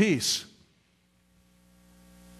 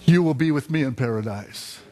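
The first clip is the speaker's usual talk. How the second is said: solemn promise; 4 semitones lower than usual. Retold or imitated. Jesus answers the dying thief.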